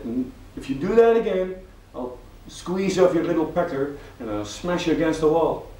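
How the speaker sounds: man yelling at dwarf, furious; angry threat. Acted. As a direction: threaten the little creature loudly.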